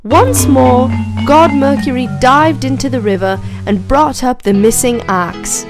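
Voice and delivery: ordinary delivery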